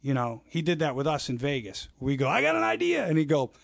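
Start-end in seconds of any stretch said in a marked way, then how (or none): 2.3-3.1 eager, excited. Retold or imitated. Eager pitch to Mick.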